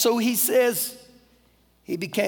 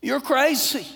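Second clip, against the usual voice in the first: mocking; 4 semitones higher than usual. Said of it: "other disciples were probably sitting in there saying".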